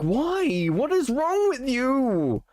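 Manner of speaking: high, drawn-out cry of disbelief; exasperated outburst. Real, not acted. Streamer berating Duma for spoiling his defense, exasperated. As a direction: wail it in sheer exasperation, stretching the words.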